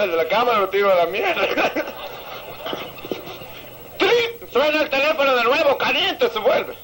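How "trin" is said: imitated phone ring. Joke, phone rings third time.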